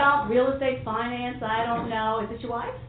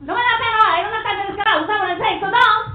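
impersonating a mother's loud, higher rant; mother's outburst; 8 semitones higher, 6 dB louder, faster than usual. Retold or imitated. Mom's impression, loud and higher. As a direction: channel your Puerto Rican mom scolding. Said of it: "she would just turn and start going".

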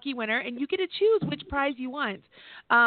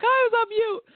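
mock squeaky imitation, pitched much higher; light, higher mimicry of the caller; 11 semitones higher, 3 dB louder than usual. Host imitates the caller who was on mute, in a high mock voice. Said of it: "She's like".

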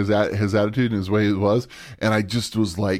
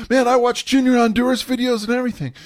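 nervous excuse; 3 dB louder than usual. Retold or imitated. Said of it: "one of the things I said to him is".

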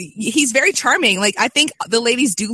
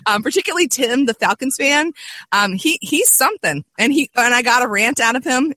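normal talking voice

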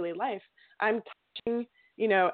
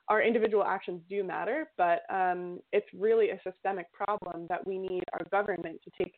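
normal talking voice

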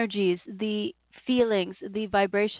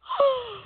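big yawn; 13 semitones higher than usual. Real, not acted. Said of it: "As I yawn them out for you".

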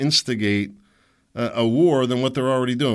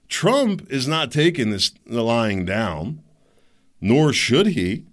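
normal talking voice

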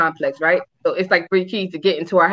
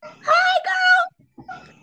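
mock bright sugary hello, much higher and louder; fake sweet greeting; 4 dB louder, much slower than usual. Teller greeting the other girl with fake high cheer.

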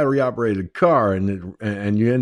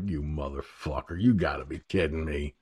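muttered curse; 9 semitones lower, 11 dB quieter than usual. Retold or imitated. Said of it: "I'm like".

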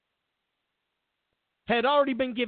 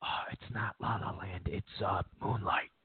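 much lower, much softer murmur; hushed producer; 14 semitones lower, 11 dB quieter than usual. Retold or imitated. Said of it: "the producer comes over and goes".

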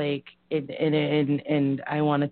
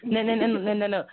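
ordinary talk

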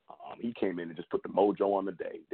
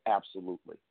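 normal talking voice

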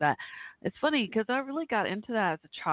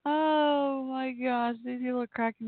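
laughing; 7 semitones higher, slower than usual. Real, not acted. Laughing at funny tweets.

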